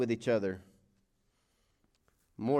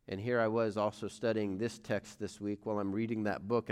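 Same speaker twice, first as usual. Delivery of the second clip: usual speaking voice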